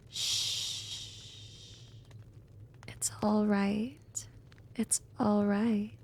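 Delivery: long soft shush, then gentle reassurance; tender, unhurried comforting; soothing. Acted. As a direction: hush and comfort someone upset. Comforting the dejected werewolf.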